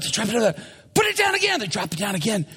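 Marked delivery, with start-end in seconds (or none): 0.9-1.6 high, urgent order; excited command. Acted. Peter ordering the crew mid-catch, excited. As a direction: bark it out pitched way up.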